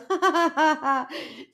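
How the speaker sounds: high, rolling mock laugh; imitated laughing. Imitating Amber cracking up on the call, imitated laughing. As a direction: laugh like the amused friend on the phone. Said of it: "she starts laughing".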